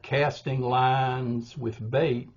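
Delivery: casting, lines and bait each stressed, slow; three-beat stress. Shown as a demonstration. Teacher showing a three-beat line, slow and stressed. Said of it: "is a three-beat line".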